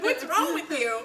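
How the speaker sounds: giggling disbelief; laughing. Retold or imitated. Daughter teasing her mom about it, laughing. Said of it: "I mean, I was like".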